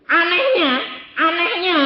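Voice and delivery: high, loud repeated wonder; amazed. Real, not acted. Preacher marvelling that God obeyed him, high and amazed.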